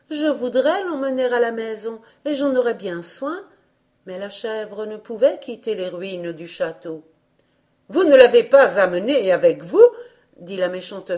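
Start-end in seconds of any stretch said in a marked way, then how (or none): 0.1-3.4 tearful plea. A narrator's reading. Césarine weeping, pleading. 7.9-9.9 suspicious stepmother, voice raised; loud sharp stepmother. A narrator's reading. Wicked stepmother questions the returning captain, loud and sharp.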